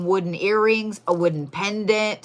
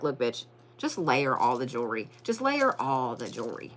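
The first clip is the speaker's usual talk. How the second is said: lower, deadpan imitation of a blunt viewer; mock sassy viewer; 6 semitones lower, 6 dB quieter than usual. Imitating viewers bossing her, low and deadpan. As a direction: drop the voice and tell her flatly to layer it all. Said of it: "y'all are gonna be like".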